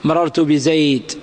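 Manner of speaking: بزيد cut to "bi-zayd", no ending vowel or n; low, slow stop on the d of بزيد; pausal sukun. Shown as a demonstration. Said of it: "سنقف عليه كما سبيل المؤلف بالسكون". (الوقف على بزيد بسكون الدال)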